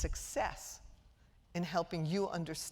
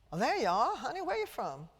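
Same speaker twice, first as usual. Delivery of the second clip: faux Southern accent; 6 semitones higher, 7 dB louder than usual. Shown as a demonstration. Lecturer greeting a Southern audience member in a fake Southern accent. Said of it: "I put on this god-awful faux Southern accent".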